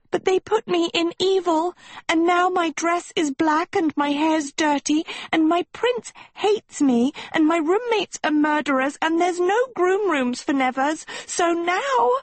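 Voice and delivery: high, breathless tearful tirade; sobbing. Acted. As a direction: pile up grievances in a wailing sob. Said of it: "Sophie sobbed".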